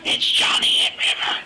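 loud gasped surprise; gasp, then call out the names; gasp and shout. Real, not acted. Player spotting the two characters, gasping loudly.

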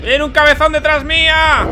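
high, loud, slightly drawn-out cry of panic; panicked yell. Real, not acted. Gamer yelling as the monster chases him, panicked. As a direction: yell it while fleeing.

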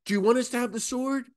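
high, quick mock-eager question; eager disciples. Disciples asking Jesus whether to fight, high and quick. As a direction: rush the question like keen followers. Disciples voiced as soldiers arrive.

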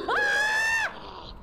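sudden high loud shriek; startled scream. Real, not acted. Streamer startled by a monster, high shriek. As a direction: scream at the jump scare. Jump scare.

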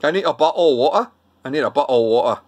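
Mancunian with dropped T's in "water"; Manchester accent. Shown as a demonstration. Vlogger trying out Manchester, mock Mancunian accent. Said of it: "how about Manchester".

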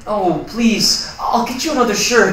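loud, high-pitched begging; desperate pleading. Acted. Roommate offering another shirt to avoid eviction, pleading.

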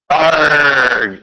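shouted pirate "Arg". Shown as a demonstration.

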